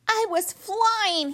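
high, slow delighted squeak; squeaky. Acted. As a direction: squeak it out with wonder. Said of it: "he squeaked".

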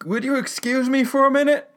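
high, loud, hurried-away request; flustered old man. Retold or imitated. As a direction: excuse yourself in an overwrought high voice. Mister Reams overcome, excusing himself.

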